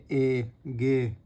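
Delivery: stretched, very slow ए then गे; sounding out the vowel. Shown as a demonstration. Teacher sounding out ए, गे for stenography students. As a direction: draw out "ए" long before "गे". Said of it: "बाद में sound आ रही है गे".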